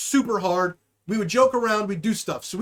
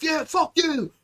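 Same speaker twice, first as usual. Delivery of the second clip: mock taunt; 6 semitones higher than usual. Acting out the staged fight's taunt.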